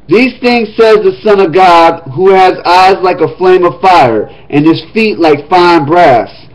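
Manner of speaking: formal reading of Revelation; scripture reading. Acted. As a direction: read the letter aloud to the congregation. Reads letter to Thyatira.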